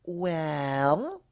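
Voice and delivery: a long questioning "Well?"; drawn-out well. Acted. Pinkie prods sleepy Rarity, drawing the word out. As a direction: stretch the word out, rising. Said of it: "drawing the word out".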